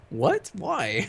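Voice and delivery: high, startled what and why; mock surprise. Replying to coworker, a mock surprised what. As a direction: act taken aback. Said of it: "And I'm like".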